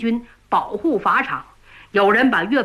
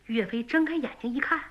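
ordinary delivery

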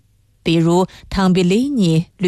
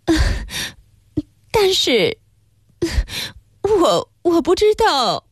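halting, high-pitched feminine stammer; 9 semitones higher than usual. A narrator's reading. Wife unsure whether to stay, high hesitant stammer. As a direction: falter between words, pitched well up. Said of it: "她结结巴巴地说".

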